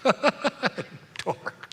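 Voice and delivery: laughs out the word Dork; laughing. Real, not acted. Pastor laughing to congregation at the dork prank.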